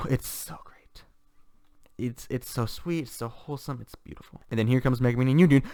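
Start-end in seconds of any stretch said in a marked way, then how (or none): 0.1-4.4 moved, hushed awe. Real, not acted. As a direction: let it fade toward a whisper, overcome. Moved by a favourite scene.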